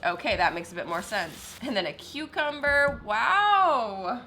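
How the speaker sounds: ordinary talk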